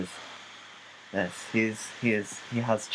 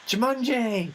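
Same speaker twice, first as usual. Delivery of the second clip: high silly voice; 13 semitones higher than usual. Pretend. Silly word play.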